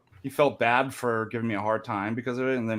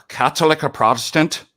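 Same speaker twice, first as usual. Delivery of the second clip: louder, higher challenge; joke gunman; 6 semitones higher, 7 dB louder than usual. Acted. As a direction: demand an answer as the gunman in a joke.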